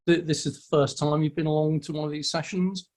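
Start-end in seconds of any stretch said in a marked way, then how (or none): none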